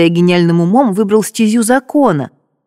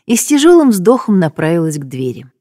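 ordinary delivery, nothing marked

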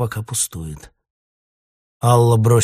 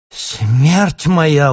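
high, loud cry of horror; horrified cry; 7 semitones higher, 7 dB louder than usual. A narrator's reading. Ksyusha sees herself in the mirror, horrified cry. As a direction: recoil with a gut cry. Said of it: "утробно отшатнулась Ксюша".

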